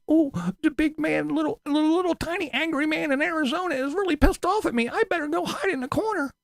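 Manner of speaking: very high, mocking scared squeak; mock frightened whine. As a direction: squeak it like a cowering coward, mocking him. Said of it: "supposed to sit here and go".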